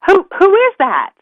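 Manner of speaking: high, loud startled question; startled beaver. Acted. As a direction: stammer it in high-pitched surprise.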